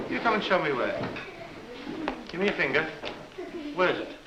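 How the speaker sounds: plain reading voice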